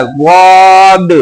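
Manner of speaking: "वाद" heavy, very long and rounded; thick वाद. Shown as a demonstration. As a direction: stretch "वाद" out thick and full. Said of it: "मोटा करके बोलना है". (मोटा करके लंबा "वाद")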